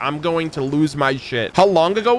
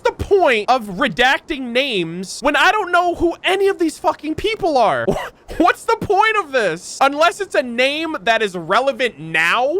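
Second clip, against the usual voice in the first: exasperated outburst; 9 semitones higher than usual. Real, not acted. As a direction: throw up your hands and yell in disbelief. Exasperated rant at redacted names.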